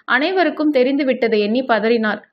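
plain reading voice

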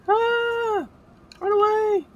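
high panicked scream while fleeing; being chased. Real, not acted. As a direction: shriek and flee in panic. Fleeing an enemy in game.

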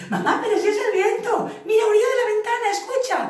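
high, fast, loud discovery; excited kitten. Acted. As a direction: tell mum it's just the wind. Child cat discovering.

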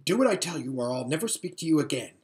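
ordinary delivery, nothing marked

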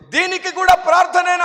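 high, loud indignant shout; mock-angry yell of the elder; shouted question. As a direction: shout it at him in disbelief. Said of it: "మొదటి నుంచి అరుస్తూనే ఉన్నాడు".

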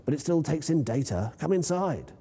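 usual speaking voice

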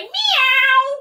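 high playful cat meow. Pretend. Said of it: "lift your back up and say".

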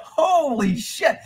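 slow high stunned murmur; awed disbelief. Retold or imitated. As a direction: stretch it out in amazement. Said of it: "I was like".

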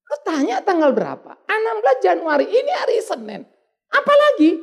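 membentak kesal dengan nada tinggi; makin marah. Retold or imitated. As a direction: menirukan suami jengkel yang menuntut jawaban. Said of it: "Makin marah lagi saya". (marah, keras dan melengking tinggi)

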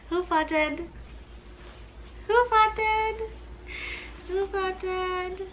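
high, soft teasing coo. Real, not acted. Teasing her baby.

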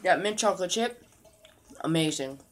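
chewing while talking; eating ice cream; mouth partly full. Real, not acted. Seen 2: spoon in mouth at -2, lips working while speaking.

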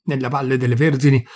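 ordinary delivery

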